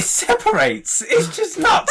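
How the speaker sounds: high laughing exclamation; excited laughing shout. Real, not acted. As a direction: blurt it out amazed. Excited about fun fact.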